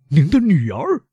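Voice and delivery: high-pitched shocked question; aghast cry, rising at the end; pained astonishment. A narrator's reading. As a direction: exclaim in disbelief, pitch leaping. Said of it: "大声说， 感到痛苦和吃惊".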